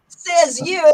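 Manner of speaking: cheeky loud comeback; sassy retort. Shown as a demonstration. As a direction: snap back in disbelief. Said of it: "and you wanna be".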